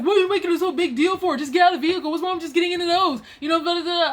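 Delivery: mock his worked-up tirade, high and pushed; retold yelling. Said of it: "why are you yelling at me".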